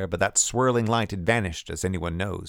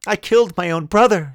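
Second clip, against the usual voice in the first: loud, high anguished cry; 10 semitones higher, 9 dB louder than usual. A narrator's reading. Woman confessing her crime, crying out. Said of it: "she cried".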